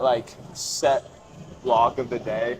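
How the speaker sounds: usual speaking voice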